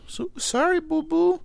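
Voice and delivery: very high cooing pet voice. Real, not acted. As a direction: coo to comfort the frightened dog. Soothing scared dog during storm.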